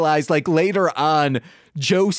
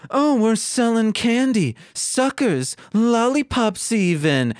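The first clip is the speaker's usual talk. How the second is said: Snagglepuss voice; 7 semitones higher than usual. Acted. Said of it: "Snagglepuss says".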